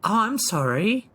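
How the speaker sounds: ordinary talk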